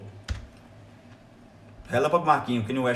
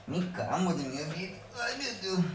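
softer, higher doting coo; soft higher pet-lover voice; tender doting; 5 semitones higher, 7 dB quieter than usual. Real, not acted. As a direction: melt over the dog. Doting on the dog.

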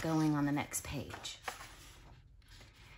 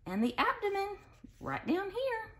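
much higher sing-song abdomen; high-pitched pointing; 14 semitones higher than usual. Acted. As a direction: squeak it up high for the kids.

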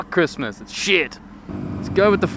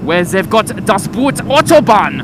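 put-on German accent; 7 semitones higher, 7 dB louder than usual. Joking about Germany.

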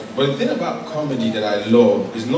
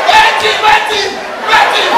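shout; 10 dB louder, slower than usual. Acted. Staging a comic apology bit.

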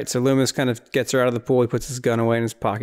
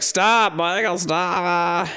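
Loomis pleading; 5 semitones higher, much slower than usual. Retold or imitated. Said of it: "Loomis is like".

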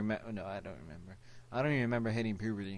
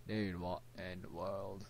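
usual speaking voice

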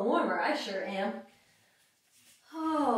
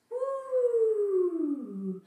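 playful woo; 8 semitones higher than usual. Pretend. Wiggling like a worm.

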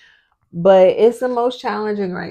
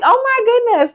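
high-pitched, louder dismayed exclamation; mock shock; 6 dB louder than usual. Mother spotting her double chin while editing videos, mock shock. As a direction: exclaim in high, exaggerated disbelief.

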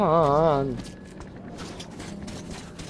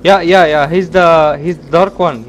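excited shouting; 2 semitones higher, 11 dB louder, slower than usual. Real, not acted. Excited about game monster.

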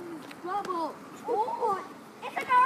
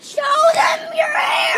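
loud high yell, slower; shouting; 9 semitones higher, 13 dB louder, slower than usual. Real, not acted. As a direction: yell it across the street. Urging friend on camera.

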